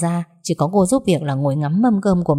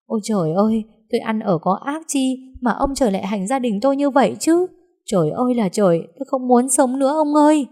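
wailing lament; 6 semitones higher than usual. Acted. Wife lamenting to her husband, wailing high. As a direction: cry out to heaven in despair. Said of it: "mếu máo khóc to".

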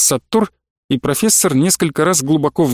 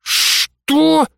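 long, very high "what"; high incredulous drawl; drawn-out disbelief; 12 semitones higher than usual. A narrator's reading. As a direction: stretch it upward in outrage. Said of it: "протянул Прыгунов".

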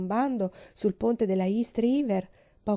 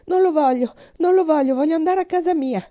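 much higher, louder crying refusal; hysterical refusal; 9 semitones higher, 8 dB louder than usual. Acted. Terrified girl refusing the chocolate mouse, hysterical shout. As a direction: shriek it out in panic.

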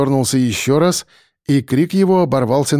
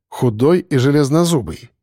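ordinary delivery

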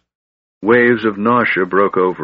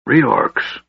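mildly irritable, pitched up; higher, testy dwarf's repetition; 5 semitones higher than usual. A narrator's reading. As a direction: repeat it with a touch of irritation. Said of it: "repeated the dwarf irritably".

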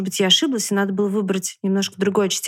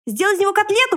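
high, furious squeal; high-pitched storybook fury; raging piglet; 12 semitones higher, 3 dB louder than usual. A narrator's reading. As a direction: rant in a high, worked-up piglet voice. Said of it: "бушевал поросёнок".

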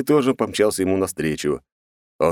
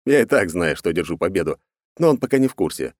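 ordinary delivery, nothing marked

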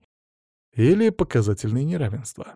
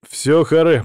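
louder, higher interruption; cutting off wailing; 5 semitones higher, 6 dB louder, slower than usual. A narrator's reading. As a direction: cut in firmly and loudly. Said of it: "прервал я стенание". (громко, обрывая нытьё)

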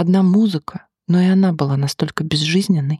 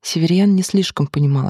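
usual speaking voice

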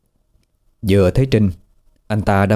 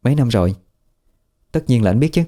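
higher female voice rising into a needling challenge; Trinh, sharp and defensive; 4 semitones higher, faster than usual. Acted. Trinh confronting Tú about his judging look, rising and needling. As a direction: call him out for judging her.

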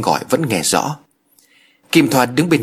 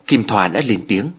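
ordinary delivery

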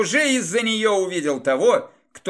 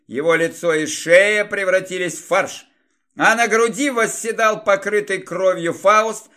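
plain reading voice

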